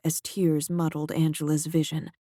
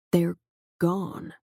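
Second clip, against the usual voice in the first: "gone" leaned on and slightly lifted; stress on gone. A narrator's reading. The director repeating it to Angela, stressing "gone". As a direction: hit the word "gone". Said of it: "emphasizing the second word".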